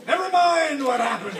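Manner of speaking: high, loud pirate captain's roar. A narrator's reading. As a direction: roar it to shut down the question. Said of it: "roared Hook".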